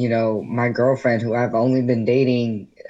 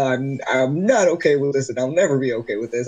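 upset dad; faster than usual. Retold or imitated. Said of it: "he went off and he's like".

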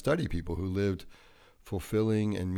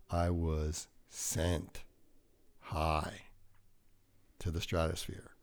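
slow drawn-out; 2 semitones lower, 5 dB quieter, slower than usual. Acted. Drawn-out joke about getting high.

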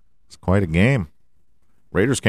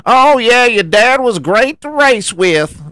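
squeaky quoted dad, higher and louder; mock dad quote; 12 semitones higher, 4 dB louder than usual. Junior quoting his dad in the mocked commentator voice, high and squeaky. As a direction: toss in dad's words in a squeak. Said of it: "'cause my pops used to say".

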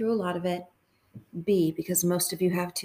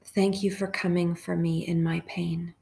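hushed, even prayer with long pauses; guided meditation prayer. Real, not acted.

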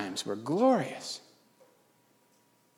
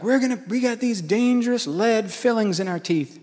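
higher-pitched mock of excited churchgoers; lightly mock them in a higher voice; earnest churchgoers; 7 semitones higher than usual. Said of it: "They're like".

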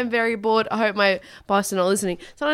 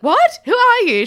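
startled; 12 semitones higher, 8 dB louder than usual. Retold or imitated. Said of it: "And I was like".

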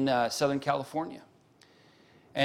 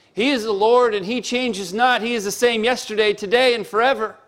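loud, higher, proclaiming from the pulpit; forceful preaching; 8 semitones higher, 7 dB louder than usual. Real, not acted. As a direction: thunder it out to the congregation.

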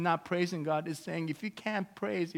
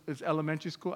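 ordinary talk, nothing marked

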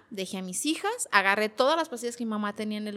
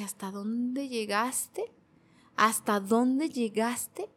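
slow self-reproach; 5 dB quieter, much slower than usual. Retold or imitated.

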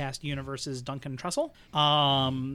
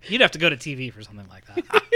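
loud, scandalized comic outrage; Kevin Meaney appalled voice; 2 semitones higher, 9 dB louder, faster than usual. Pretend. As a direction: sputter it like a shocked comic. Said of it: "This is just a very appalled voice".

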